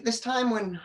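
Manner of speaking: ordinary delivery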